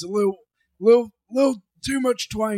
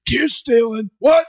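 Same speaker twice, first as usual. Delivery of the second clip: loud, higher shouted promo; wrestler promo quotes; 5 semitones higher, 5 dB louder than usual. Retold or imitated. Podcaster shouting famous wrestlers' catchphrases. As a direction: bellow like a wrestler in the ring. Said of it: "Start saying other wrestlers' quotes".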